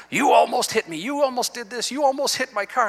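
retelling a heated road quarrel with a rising indignant voice; indignant retort. Retold or imitated.

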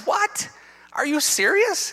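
a high, yelped what, then are you serious; shocked disbelief. Retold or imitated. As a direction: replay the shock of being ticketed. Shocked at ticket.

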